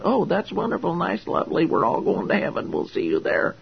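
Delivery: mocking, sugary high start fading into a whisper; mock cheerful. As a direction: parody the smug believers, lightly. Mocking complacent believers.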